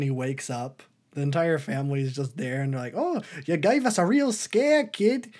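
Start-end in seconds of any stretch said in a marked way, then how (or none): 2.9-5.3 high, loud mock-Australian granny; Australian grandma exclaiming, pitched way up; Australian old lady. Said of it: "I don't know why I'm doing Australian for an old lady".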